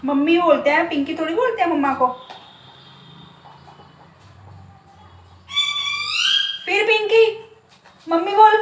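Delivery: loud, high scolding of a cheeky pet. Real, not acted. Mother scolding her parrot for calling her Pinky, loud and high. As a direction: tell the parrot off loudly.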